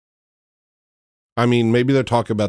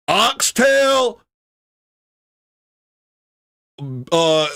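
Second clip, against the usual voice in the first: hammed-up caricature voice; 13 semitones higher, much slower than usual.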